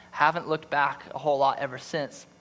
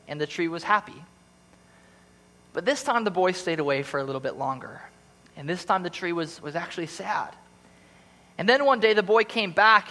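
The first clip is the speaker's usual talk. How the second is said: story narration; 4 semitones higher than usual. A narrator's reading. Pastor reading The Giving Tree to church, tender storytelling. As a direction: read it like a bedtime story to the congregation.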